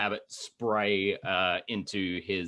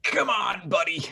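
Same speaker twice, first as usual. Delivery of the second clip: excited shout; 10 semitones higher, 4 dB louder than usual. Real, not acted. Player urging a good roll.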